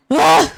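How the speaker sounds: loud exasperated ugh; frustrated groan. Real, not acted. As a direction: let the frustration burst out in one groan. Frustrated after stammering.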